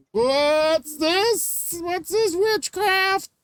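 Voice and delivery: very high, strained mock alarm; hammed-up horrified prude; scandalized outcry. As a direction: cry out high, aghast, stretching the last word. Acts out scandalized reaction to Maude.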